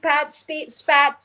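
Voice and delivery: normal talking voice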